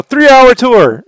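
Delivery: high, loud, drawn-out "Three-hour tour"; yelled lyric. Retold or imitated. Husband blurting lyric at wife, teasing yell. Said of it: "I'll just yell".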